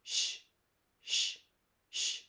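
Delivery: soft hushing sh, held; a long, quiet shh; isolated sh sound. Shown as a demonstration. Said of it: "The middle sound that we are looking at today is".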